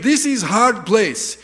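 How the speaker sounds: put-on slow, loud, high Russian accent; hammed-up Russian warning; Russian driver. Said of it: "he kept on saying".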